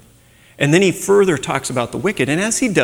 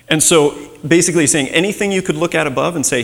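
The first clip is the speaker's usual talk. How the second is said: normal talking voice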